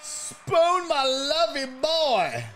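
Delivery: ordinary talk, nothing marked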